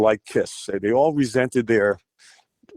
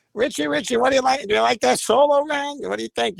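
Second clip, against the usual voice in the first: much higher imitated Bronx accent, pitched up all through; 14 semitones higher than usual. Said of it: "he had that great Bronx accent".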